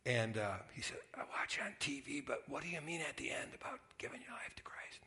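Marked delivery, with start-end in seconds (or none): 1.1-5.0 frail, breathy whispered question; faint whisper. Retold or imitated. Dying uncle asking his nephew about faith, in a frail whisper. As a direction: ask it in a weak whisper, short on breath.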